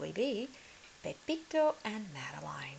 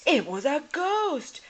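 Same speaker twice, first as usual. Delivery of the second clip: very high, loud panicked yell; terrified cry; 11 semitones higher, 12 dB louder than usual. Acted. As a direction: cry out in fright. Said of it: "he cried".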